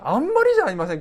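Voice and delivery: loud, high mock outrage; hammed-up aggrieved appeal; acted exasperated protest. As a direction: burst out as if badly wronged. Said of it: "納得いかなかったんじゃないかなと".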